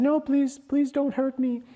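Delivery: high, pleading little sheep voice; frightened sheep-lion. Acted. Frightened cub begs again.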